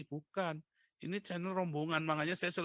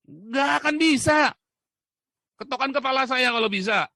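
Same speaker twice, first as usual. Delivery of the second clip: high, very loud defiance; shouted challenge; 9 semitones higher, 13 dB louder than usual. Real, not acted. Preacher challenging the pastor's claim, defiant shout. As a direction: dare them at the top of your voice.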